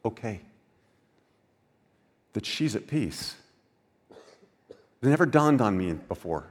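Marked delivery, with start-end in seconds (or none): 0.0-3.3 soft acceptance. Pretend.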